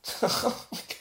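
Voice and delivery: short unvoiced chuckle; quick breathy disbelieving laugh. Real, not acted. Laughs at the penguin claim.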